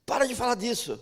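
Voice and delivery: imitating the bullies telling him to quit; angry demand. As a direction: order him to shut up, mildly acted.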